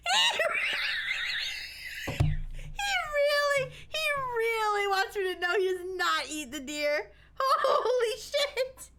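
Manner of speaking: high laughing through leftover fright; shaken laughter. Real, not acted. Streamer laughing after the Santa bot scared him. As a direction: laugh it off, still rattled.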